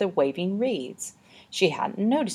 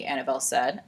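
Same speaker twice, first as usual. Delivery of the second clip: usual speaking voice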